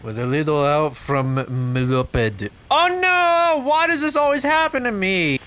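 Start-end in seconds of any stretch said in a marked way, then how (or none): 2.7-5.4 theatrical high-pitched lament; mock despair. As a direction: wail "woe is me", hammed up. Dismayed at mishap in game.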